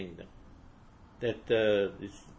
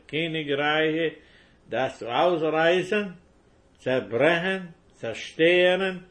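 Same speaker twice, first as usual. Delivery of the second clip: building preaching; 4 semitones higher, slower than usual. Acted. Preacher to congregation, building from measured reading to a shout. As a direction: build the proclamation up to a ringing peak. Preaching commission, rising to climax.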